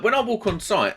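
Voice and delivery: ordinary talk, nothing marked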